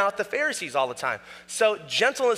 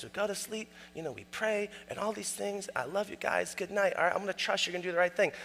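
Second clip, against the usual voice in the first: much softer, syrupy sweet bedtime voice; mock tender dad; 9 dB quieter than usual. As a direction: coo it like the gentle dad he isn't. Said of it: "it's not like".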